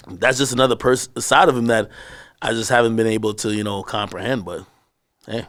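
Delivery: normal talking voice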